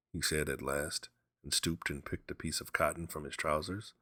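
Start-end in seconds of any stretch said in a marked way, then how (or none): none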